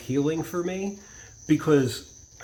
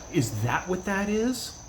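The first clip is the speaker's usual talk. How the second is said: slow, higher-pitched dawning question; retold realization; 5 semitones higher, slower than usual. Bartender learns what narcissistic people are like, amazed realization. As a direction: imitate someone astonished as it clicks.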